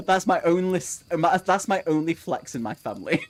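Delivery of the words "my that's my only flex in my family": ordinary delivery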